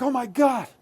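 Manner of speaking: mock panic on waking from a nightmare; gasped shock, pitch jumping high; startled gasp. Waking from a bad dream.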